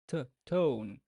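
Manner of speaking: "T" isolated then "tone" with the lift at the start; stress on T. Shown as a demonstration. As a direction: tap the T, then rise into "tone". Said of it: "first syllable received the stress".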